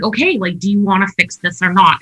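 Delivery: ordinary talk, nothing marked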